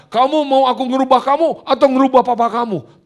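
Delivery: high, loud challenge; voice of God. Acted. God questioning the preacher in prayer, high loud challenge. God's line in an acted dialogue.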